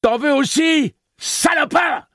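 high, loud furious shriek; shrieking at Roland. Acted. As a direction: spit the insult as a wild woman. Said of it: "hurla-t-elle à Roland".

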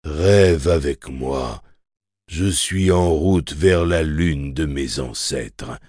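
slow, wavering sing-song voice; strange trembling voice. Acted. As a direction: quaver it out slowly, half chanting. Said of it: "d'une étrange voix tremblotante".

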